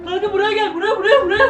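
high urgent calls, half laughing; frantic calling. Real, not acted. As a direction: call them over in a fright. Calling friend over in fright.

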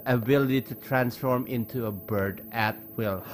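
normal talking voice

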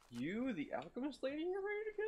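high, rising teasing question; sing-song it at the lady; mock lilting question. Player asking the alchemist lady if she's ready, high teasing lilt.

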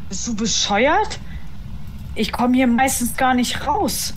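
slow, loud and high outburst. Real, not acted. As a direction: shout it out, still panicked. Panicked refusal after the chase.

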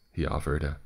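plain reading voice

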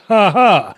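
triumphant gotcha laugh. Pretend. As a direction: crow as if you caught them out. Gotcha after tricking the listeners.

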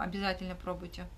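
usual speaking voice

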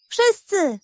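high, hammed-up doom cry; dramatic cry. A narrator's reading. Pug scaring the hen, dramatic and high. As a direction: wail it theatrically. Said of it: "zawołał dramatycznie".

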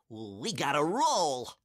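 put-on stretched-out boy's call to flee; rally your friend to get away; urgent cry. A narrator's reading. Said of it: "cried George".

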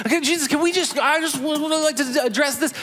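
high, hopeful pleading as Peter; eager Peter. Retold or imitated. Peter begging Jesus for a word, high and eager. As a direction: pitch way up, nervously eager to talk. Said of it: "And then Peter goes".

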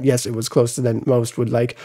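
low, soft throwaway aside; quiet aside. Real, not acted. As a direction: drop the voice to concede it. Concession aside.

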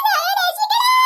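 put-on high, wailing child's plea; cry for help. Child being chased begs rescue, high wailing cry. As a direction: shriek for rescue in play-acted panic.

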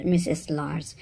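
plain reading voice

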